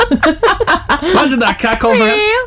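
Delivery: laughing through the words; giggling as you speak. Real, not acted. Laughing while setting up impression.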